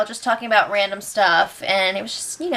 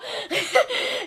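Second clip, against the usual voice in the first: short high burst of laughter; 10 semitones higher, 6 dB quieter than usual. Real, not acted. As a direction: laugh at your own bit. Laughs after quote.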